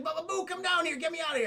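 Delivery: high-pitched call upward; urgent plea. Retold or imitated. Chained Aladdin calls his monkey for help, urgent plea.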